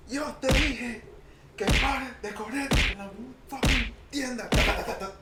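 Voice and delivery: high-pitched imitation of an angry parent; mock exasperated parental scolding. Parent scolding kids in a store.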